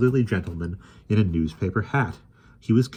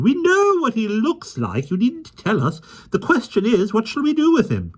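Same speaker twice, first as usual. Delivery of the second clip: high, firm interrupting voice; impatient lady voice; 5 dB louder than usual. A narrator's reading. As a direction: cut him off impatiently. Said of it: "Miss Lark broke in".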